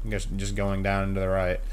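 normal talking voice

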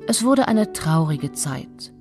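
ordinary delivery

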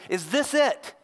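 high, exasperated question; acted desperate demand, pitched high; despairing challenge to God. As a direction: throw it up at God in disbelief. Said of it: "basically what he says to God is".

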